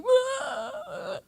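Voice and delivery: playful fake sob; mock wail. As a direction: imitate bawling at the movie. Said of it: "I'm like".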